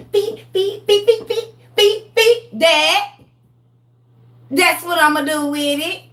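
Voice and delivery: high, giggly beep beep sword noises; imitated sword swishes. Daughter mimics swinging the sword at her brother, giggly sound effects. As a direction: laugh through playful sword sound effects.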